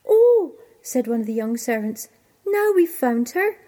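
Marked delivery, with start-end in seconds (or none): 0.1-0.5 high, loud "oh" of delight; thrilled exclamation. Acted. Young servant thinks the nightingale is found, thrilled. 2.5-3.6 high, loud and thrilled; excited discovery. Acted. Young servant thinks the cow is the nightingale, excited.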